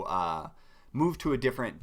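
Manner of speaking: ordinary talk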